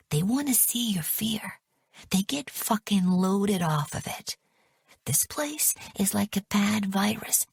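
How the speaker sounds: unvoiced urgent warning; urgent whisper. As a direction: hiss a warning under the guards' noses. Urgent warning among captives.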